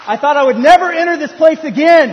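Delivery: high, loud mock anguish; exasperated outcry. Father back in the baby store, loudly exasperated. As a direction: cry out against going back.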